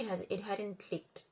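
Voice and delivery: ordinary talk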